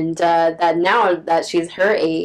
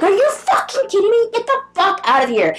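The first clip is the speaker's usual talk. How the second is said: screaming, much higher and louder; furious shout; 6 dB louder than usual. Retold or imitated. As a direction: snap and yell at the creep. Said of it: "I fucking just snapped".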